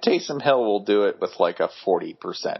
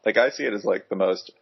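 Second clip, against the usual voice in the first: ordinary talk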